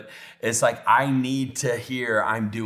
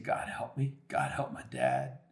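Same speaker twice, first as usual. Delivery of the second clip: softly pleading, understated; hushed, mildly anguished prayer; retold tearful prayer; 10 semitones lower, 9 dB quieter than usual. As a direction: plead quietly, retelling a cry for help. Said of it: "crying and just saying".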